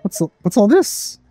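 normal talking voice

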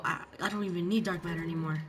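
usual speaking voice

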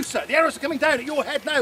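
ordinary talk, nothing marked

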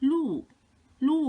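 ลู่ in Mandarin fourth tone, falling. Shown as a demonstration. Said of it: "คือเสียงที่สี่". (ออกเสียง ลู่ เป็นเสียงที่สี่)

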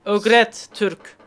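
yavaş, bozuk Türkçeli yabancı rolü, sonra yüksek sesle İngilizce; Türkçe bilmiyormuş gibi yapan turist; yabancı taklidi. Pretend. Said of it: "çok iyi bir troll var kafamda". (karşıdakini trollemek için yabancı numarası yapan yayıncı)

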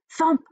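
punchy high thump noise; thump sound effect. Acted. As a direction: make the thump pop out. Story sound, thump on roof.

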